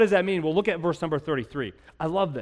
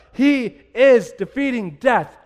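slow, loud, higher proclamation; triumphant shout; 7 semitones higher, 9 dB louder, slower than usual. Acted. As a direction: shout the victory out slowly. Climax of the sermon.

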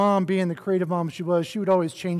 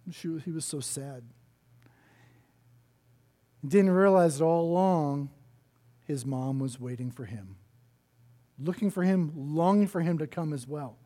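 softer, lower and heartfelt; tender; 4 semitones lower, 5 dB quieter than usual. Real, not acted. Preacher telling the congregation the mother waited, tender and soft. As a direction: let it land gently.

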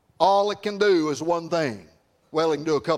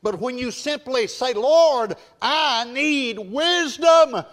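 higher, full-voiced pulpit delivery; impassioned preaching; 7 semitones higher, 4 dB louder than usual. Real, not acted. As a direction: preach it out, stretching the key words.

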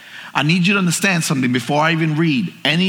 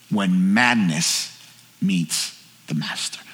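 very low, slow, fading to soft; grave, drawn-out repeat of the key phrase; hushed solemn refrain; 9 semitones lower, 4 dB quieter, slower than usual. Real, not acted. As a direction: sink deep and let it trail into quiet. Refrain repeated, lower and softer.

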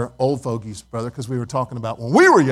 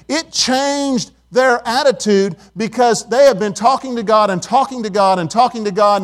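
louder, higher, driving sermon voice; full-force preaching; 6 semitones higher, 4 dB louder than usual. Acted. Pastor urging his congregation to fear God, full-force preaching. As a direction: preach it to the back row.

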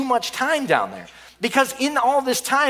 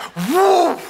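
whoosh of flames; 9 semitones higher, 4 dB louder than usual. Retold or imitated. Water makes flames explode.

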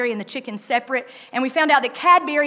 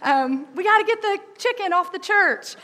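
urgent call; 9 semitones higher than usual. Retold or imitated. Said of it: "I'm like".

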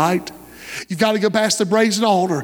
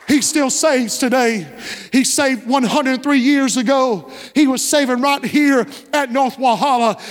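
higher-pitched, driving sermon delivery; impassioned preaching; 4 semitones higher than usual. Real, not acted. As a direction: preach it with building fervor to the congregation. Preaches at rising force to congregation.

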